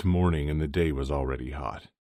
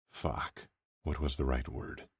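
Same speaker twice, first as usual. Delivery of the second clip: ordinary delivery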